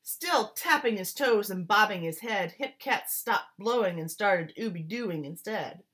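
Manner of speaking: put-on lively rhythmic read-aloud, like reading to children; story narration. A narrator's reading. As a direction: swing the rhymes like a jazz storyteller. Reads picture book aloud.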